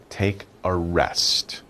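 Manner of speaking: slow, drowsy, soft repeat. Shown as a demonstration. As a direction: ease off as if resting. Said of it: "You're resting".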